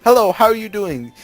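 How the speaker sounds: a cheerful example greeting; demo greeting. Shown as a demonstration. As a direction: model the line for them to copy. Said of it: "Let's try it this way".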